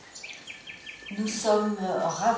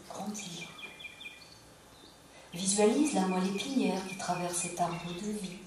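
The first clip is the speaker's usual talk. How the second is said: calm yoga nidra guidance with long pauses; unhurried meditation voice, pausing between phrases; guided relaxation; 4 dB quieter than usual. Acted. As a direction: guide them gently into rest, phrase by phrase.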